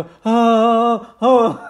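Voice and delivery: loud, high halting hoot; mimicked interruption. Friend mimics him breaking into the point, loud and high. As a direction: break in with a big mock halt. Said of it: "then he decides to go".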